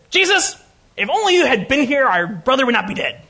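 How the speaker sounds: high, loud, indignant outburst; angry little lady. Acted. Martha reproaching Jesus over her dead brother, angry and high. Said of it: "imagine angry little lady".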